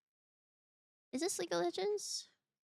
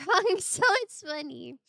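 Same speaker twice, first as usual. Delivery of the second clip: laughing; 2 semitones higher, 11 dB louder than usual. Real, not acted. Teasing the chat, laughing.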